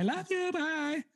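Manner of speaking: very high mock-sweet goodbye; sugary sign-off. Streamer signing off her video to fans, mock sweet. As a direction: coo it way up high like a streamer blowing kisses. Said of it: "the first video she went".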